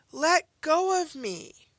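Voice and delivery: high, loud, slow demand to be released; urgent shout. Acted. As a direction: yell it as if grabbed. Reading an exclamatory command.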